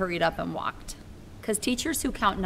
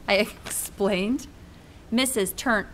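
usual speaking voice

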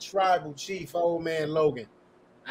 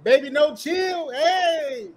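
hype shout; 11 semitones higher, 7 dB louder, slower than usual. Acted.

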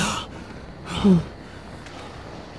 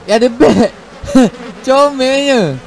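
higher, louder delighted outburst; excited; 6 semitones higher, 4 dB louder than usual. Real, not acted. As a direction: squeal a little at a cute creature. Spots a bat in game.

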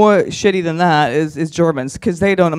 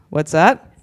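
ordinary delivery

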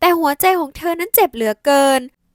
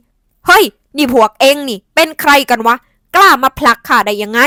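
furious demand; 8 dB louder than usual. A narrator's reading. Aunt pushed, furious at strangers.

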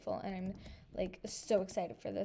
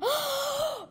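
sharp shrieking gasp, much higher and louder; high excited gasp; 7 dB louder than usual. Real, not acted. Gamer gasping at the pet she wanted, high and loud. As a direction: gasp in pure delight.

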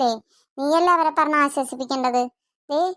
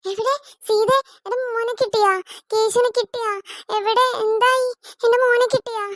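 anxious pleading; 6 semitones higher than usual. Acted. Mother asks after lost son.

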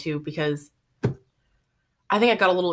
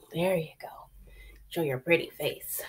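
cooing to the cat; 3 semitones lower, 7 dB quieter than usual. Real, not acted. Coaxing the cat on camera.

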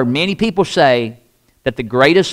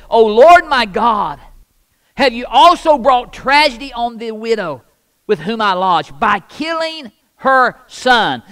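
crying out to God; 7 semitones higher than usual. Acted. Elijah cries out to God over the dead boy, raised pleading. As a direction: cry out to the Lord from raw emotion. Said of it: "he cried out to the Lord".

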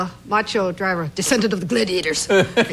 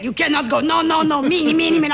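mock the Vatican guard scolding; guard calling out; 5 semitones higher, 5 dB louder than usual. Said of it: "they're saying".

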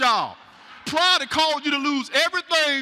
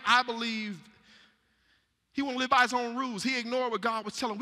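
normal talking voice

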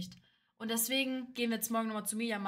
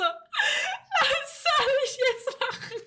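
much higher, slow, laughing sob; mock crying wail; 13 semitones higher, 4 dB louder, much slower than usual. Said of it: "hab geweint".

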